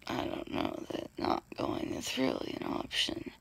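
ordinary talk

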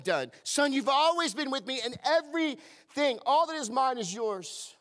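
rising full-force preacher; fervent preaching. Real, not acted. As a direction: preach it out to the room.

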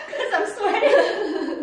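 slow high laughing. Real, not acted. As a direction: stretch it out laughing. Laughing, needs a break.

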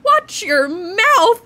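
loud, slow scolding shout; mock-outraged shout at a swearing kid; mock scolding yell.

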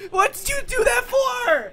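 high, loud wail of complaint; wailing protest. Real, not acted. Player blaming a friend for the scare, wailing protest. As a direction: wail it at the friend who tricked you.

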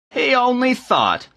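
high, drawn-out incredulous mimicry; mocking repeat. A narrator's reading. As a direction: throw his excuse back with scorn. Bishop mockingly repeats the excuse.

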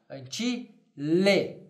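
drawn out, each syllable clear; slow careful Latin model. Shown as a demonstration. Teacher modelling Latin slowly for students. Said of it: "da escrava".

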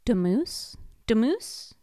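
Demoose blended as de-moose; sounding it out. Shown as a demonstration. Teacher sounds out the word for kids. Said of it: "Oh, let's sound this out".

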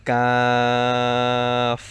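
long কাফ, slightly forward of the tongue root; slow, held demonstration of fronted কাফ; forward k letter. Shown as a demonstration. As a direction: stretch কাফ out loudly, made further forward on the palate. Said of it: "জিহ্বার গোড়া হতে একটু আগে".

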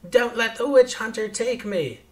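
loud, high-pitched frightened plea; half-screamed cry for protection; mock scream. Little girl begs a stranger to save her, mock scream. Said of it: "grabs onto Nino's pants and screams".

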